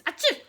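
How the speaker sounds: sudden high, loud mock sneeze; sawdusty sneeze. The Lorax sneezing sawdust before he speaks, loud sneeze. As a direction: sneeze it out big and dusty. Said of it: "with a sawdusty sneeze".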